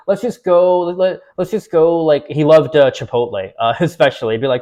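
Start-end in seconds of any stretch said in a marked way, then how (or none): 0.1-2.0 higher, wheedling buddy voice; coaxing friend. Retold or imitated. Friend urges the speaker to go eat, higher wheedling voice. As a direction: coax him into skipping it and going out.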